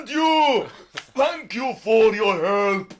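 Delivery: loud, high, slow giant voice; grateful giant. Acted. Giant thanking the players for help, loud and slow. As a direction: thank them grandly like a noble giant. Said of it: "And he's like".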